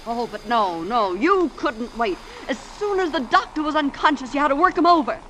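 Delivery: ordinary talk, nothing marked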